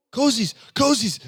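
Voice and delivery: shouting a name across the room; calling out loud. Real, not acted. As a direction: call out, high and loud. Calling out for missing tags.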